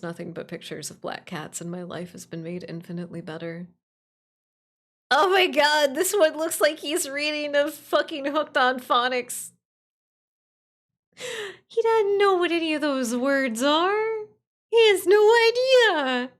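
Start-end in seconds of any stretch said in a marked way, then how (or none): 5.1-16.3 high, loud, gushing squeal; excited squeal. Real, not acted. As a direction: coo over a cute cat. Gushing over cat pictures.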